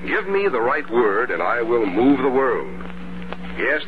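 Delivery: ordinary talk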